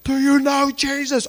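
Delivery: loud, high call from a car; man leaning from his car calling to drivers. Retold or imitated. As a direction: shout it out the window.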